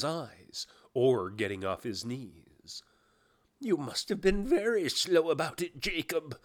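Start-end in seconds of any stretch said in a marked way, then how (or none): 3.6-6.4 higher, deferential query; businesslike but humble. Acted. Scrooge questions his dead partner Jacob, humbly businesslike. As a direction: put it to him matter-of-factly yet respectfully. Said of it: "observed in his businesslike manner, though with humility and deference".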